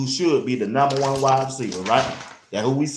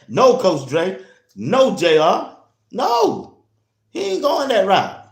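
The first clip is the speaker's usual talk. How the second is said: loud, much higher no-no-no protest; excited protest; 10 semitones higher, 6 dB louder than usual. Real, not acted. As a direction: shout him down with laughing disbelief. Excited denial to co-hosts.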